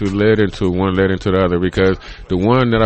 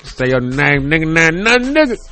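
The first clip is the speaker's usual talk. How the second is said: mock Rudy shout; 8 semitones higher than usual. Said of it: "nobody say your name like".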